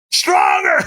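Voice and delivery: high shouted command; screamed command. Retold or imitated. The trainer screams at her to go stronger. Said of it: "screamed at me".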